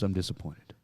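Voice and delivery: a hushed, heavy reprimand; quiet disappointed rebuke. Retold or imitated. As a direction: say it quietly like a let-down officer. Captain's quiet rebuke retold.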